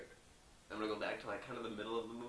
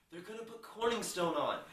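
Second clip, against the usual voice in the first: raised, louder outburst; excited announcement; 9 semitones higher, 6 dB louder than usual. Retold or imitated. Ron to the newsroom, raised and excited. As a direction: blurt out the shocking news. Ron hears Corningstone will anchor.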